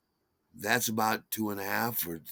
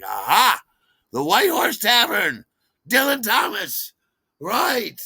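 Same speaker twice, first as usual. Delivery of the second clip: much higher, louder triumphant cry; eureka shout; 10 semitones higher, 8 dB louder than usual. Retold or imitated. Remembering the tavern, he shouts triumphantly to his wife. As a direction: shout it as it all comes back. Sudden recollection.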